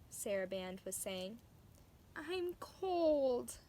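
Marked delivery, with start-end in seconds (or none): 2.2-3.6 high sulky whine; whiny complaint. A narrator's reading. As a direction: whine like a cold, sulking kitten. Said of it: "complained Princess".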